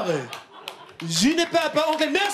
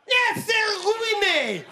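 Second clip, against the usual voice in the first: mock shout; 8 semitones higher than usual. Comic outburst on stage.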